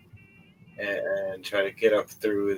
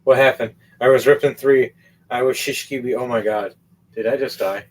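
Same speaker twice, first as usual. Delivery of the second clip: freaking out; 3 semitones higher, 6 dB louder than usual. Acted. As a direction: blurt it out in shock. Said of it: "freaking out, going".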